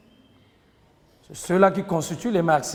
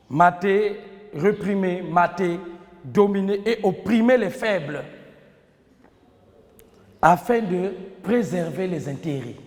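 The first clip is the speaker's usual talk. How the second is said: dictating. Shown as a demonstration. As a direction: dictate phrase by phrase, pausing between. Teacher dictating to class. (dictée lente, en détachant chaque groupe de mots)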